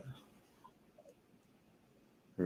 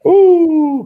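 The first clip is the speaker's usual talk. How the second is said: excited ooh; 6 dB louder than usual. Real, not acted. Bid jumps during live auction.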